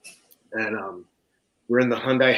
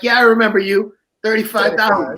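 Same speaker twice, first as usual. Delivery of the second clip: higher, deadpan-sarcastic bartender; 8 semitones higher, 5 dB louder than usual. Retold or imitated. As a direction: humor him, then hand over the bill.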